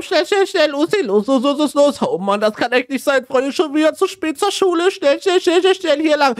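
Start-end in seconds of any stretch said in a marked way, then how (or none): 0.0-2.0 higher, urgent rushing calls; frantic hurry. Acted. Rushing friends late to class. 3.2-6.3 higher, urgent rushing calls; frantic hurry. Acted. Late again, urging friends on.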